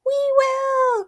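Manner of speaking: very high, loud mice chorus; shrill squeaky mice chorus. A narrator's reading. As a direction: squeal it together as eager mice. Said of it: "in a shrill chorus".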